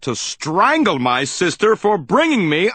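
building to a high frustrated shout; rant, rising into a shout; exasperated. A narrator's reading.

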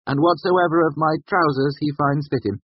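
high, grandly formal dictation; magnanimous. A narrator's reading. Bast continuing his will, magnanimously. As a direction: hand out gifts with lofty generosity. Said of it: "continued magnanimously".

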